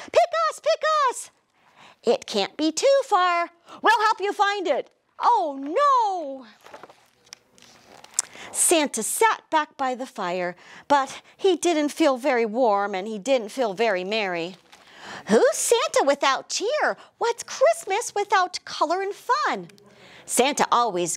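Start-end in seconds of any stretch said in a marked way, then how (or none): 0.1-6.5 very high squeaky excited crayons; crayon voices. Acted. Crayons answer Santa. 15.3-19.7 high wondering little voices; crayon voices. Acted.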